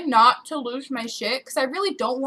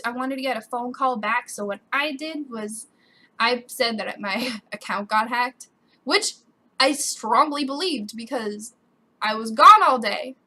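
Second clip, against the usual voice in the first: usual speaking voice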